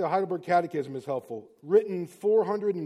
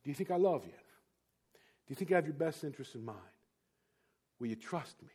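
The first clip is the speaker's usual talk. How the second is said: soft fatherly question; 5 semitones lower, 9 dB quieter than usual. Retold or imitated. Father voice repeated quietly.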